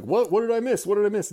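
loud, high, urgent questioning; eager buddy. Retold or imitated. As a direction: act out someone desperate to be let in. Imitating eager newcomer buddy.

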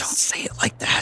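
a flustered whispered protest. Acted. Embarrassed, he whispers back at his teasing friend. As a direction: hiss it back without waking anyone.

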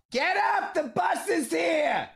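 high, shouted mother yelling upstairs; shrill nagging mom. Retold or imitated. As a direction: yell like a fed-up mom waking her kid. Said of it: "my mother's voice coming from the bedroom".

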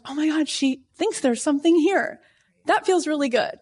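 high, delighted retelling of her excitement; thrilled gushing. Retold or imitated. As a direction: gush like you just got good news. Retells thrill over first agent.

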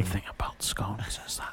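breathy ASMR whisper. Pretend. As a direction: whisper it close to the mic. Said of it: "I'll A S M R the rest of this podcast".

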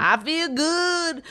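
slow, high, stretched-out brag; hammed-up self-satisfied croon; transvestite impression. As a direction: luxuriate in it like a preening diva. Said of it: "that's my transvestite voice".

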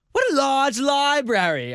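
high, slow British gush; British accent. Retold or imitated. Date admiring his library, mock British accent. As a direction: coo it in a put-on British voice. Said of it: "I don't know why you're British".

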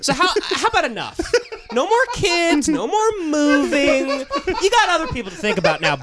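high, loud, laughing tough-guy voice; laughing mock mobster voice. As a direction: lay down the law while cracking up.

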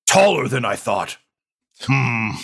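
lightly voiced brute, mildly impressed; wry thug voice. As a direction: size him up with a grunt. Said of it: "looks all the way up at you and he says".

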